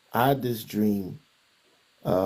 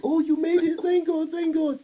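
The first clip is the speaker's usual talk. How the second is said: much higher, sing-song polite voice; wife politely welcoming him, high and civil. Retold or imitated. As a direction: mimic a courteous, distant greeting. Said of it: "she was very, very civil with me".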